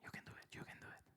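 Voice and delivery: fast whispered encouragement; whispering. Real, not acted. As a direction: whisper it quickly, off-mic. Host coaching guest from the side. (susurro rápido de ánimo)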